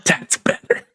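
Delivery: whispered aside; whispering. Real, not acted. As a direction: breathe it without voice. Quiet aside.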